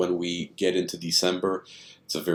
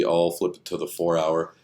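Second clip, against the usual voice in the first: normal talking voice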